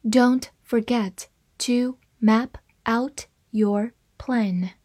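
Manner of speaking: slow, word-by-word model reading. Shown as a demonstration. English teacher models the sentence for learners, reading slowly. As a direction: read it very slowly, pausing between words. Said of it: "慢慢来读".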